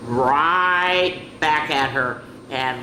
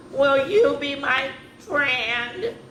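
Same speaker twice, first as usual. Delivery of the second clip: higher, slow, hopeful plea; Penelope asking the goldfish to be her friend; hopeful little dinosaur; 9 semitones higher, slower than usual. Acted. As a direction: ask sweetly and slowly, hoping for a yes. Penelope asks goldfish shyly.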